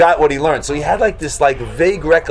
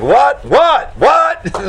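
rising, high-pitched repeated whats; incredulous, escalating whats; baffled disbelief; 10 semitones higher, much slower than usual. Acted. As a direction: fire off baffled whats, each higher. Acting out baffled reaction.

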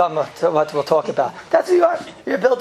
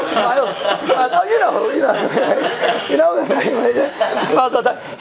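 laughing; 5 semitones higher than usual. Real, not acted. Laughing about smiling chavrusa.

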